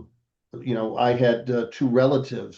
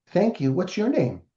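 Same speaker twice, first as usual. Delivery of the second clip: higher-pitched voice of a polite daughter; little girl; 7 semitones higher than usual. Retold or imitated. Daughter answers the massive strongman, polite little-girl voice. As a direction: imitate a small girl, pitched well up.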